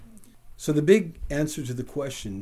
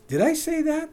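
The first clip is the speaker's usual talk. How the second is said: tycoon playing innocent, pitched high; mock-shocked, high question; feigned surprise; 14 semitones higher than usual. Preacher voicing the forgetful tycoon, mock innocent high surprise. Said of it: "the tycoon asked".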